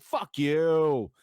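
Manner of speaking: loud, high mock teenage yell; retold defiant yell. Teen answering dad's orders, retold defiant yell. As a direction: hurl it back in comic defiance. Retelling teenage fight with dad.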